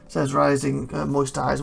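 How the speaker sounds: ordinary delivery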